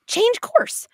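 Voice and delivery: high, loud cry to change course; outraged passenger. Pretend. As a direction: yell it at the pilot in disbelief. Passenger demanding action.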